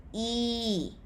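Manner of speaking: "E" held long as an even ee; long flat tone. Shown as a demonstration. Teacher summing up the syllable, long flat tone. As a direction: draw out "E" on a steady tone. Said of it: "has flat tone".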